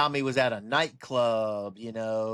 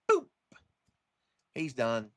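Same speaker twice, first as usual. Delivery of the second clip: normal talking voice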